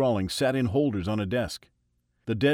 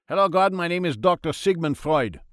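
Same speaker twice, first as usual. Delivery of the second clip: put-on high, loud German-accented doctor; a jolly German doctor offering cocaine; Freud in German accent; 7 semitones higher, 6 dB louder than usual. A narrator's reading. Freud offers God cocaine, German-accented and high.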